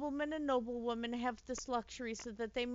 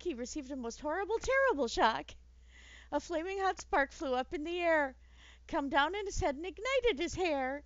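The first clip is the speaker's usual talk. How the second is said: bright, lively rhyming read-aloud, higher; storytelling voice; 5 semitones higher, 3 dB louder than usual. Acted. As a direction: read it like a bedtime picture book.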